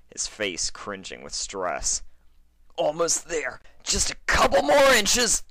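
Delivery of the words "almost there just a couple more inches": high, loud straining push; straining effort. Acted. Stallion squeezing through the window, straining shout. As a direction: force the words out mid-strain.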